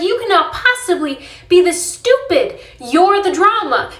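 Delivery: exasperated friend telling him off; exasperated scolding. Retold or imitated. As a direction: lay into him, word by word. Berating her buddy.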